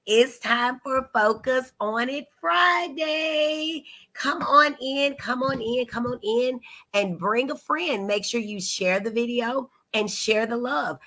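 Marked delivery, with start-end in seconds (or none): 0.0-6.2 slow, sing-song host announcement, pitched higher; drawn-out welcome to the weekly show; show opener. Acted. Show host opening the livestream.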